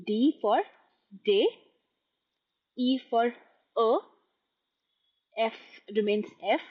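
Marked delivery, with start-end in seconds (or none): 1.3-1.5 "day" as the French name of D, a pure short vowel; French letter D, said "day". Shown as a demonstration. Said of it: "then I'll say the French one". 3.8-4.0 "a" as the French E, a rounded uh; French letter E, written "a", said uh. Shown as a demonstration. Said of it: "then I'll say the French one".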